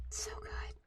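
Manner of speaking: breathy whisper of delight. Real, not acted. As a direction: whisper it like a treat. Savoring strawberries.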